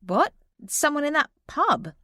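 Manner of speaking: can't believe it; surprised friend. Acted.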